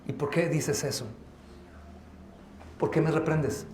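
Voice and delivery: low, very soft grumble; muttered resentment. Acted. Church member resenting a rebuke, low mutter. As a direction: mutter it under your voice.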